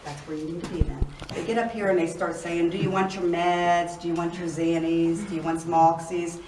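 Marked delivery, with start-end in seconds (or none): none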